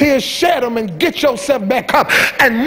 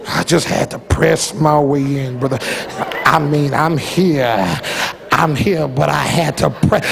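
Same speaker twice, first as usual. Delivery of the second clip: very low, slow, dragging mock-exhausted voice; exaggerated weary testifier; 10 semitones lower than usual. Preacher mocks a member who always complains about pressing their way in. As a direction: ham up the put-upon churchgoer, dragging each word. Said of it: "Every time you come".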